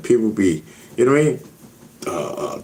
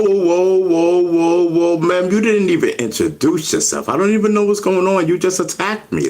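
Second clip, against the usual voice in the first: startled outrage; 7 semitones higher than usual. Real, not acted. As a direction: push back, stunned she came at you. Spam caller cuts him off.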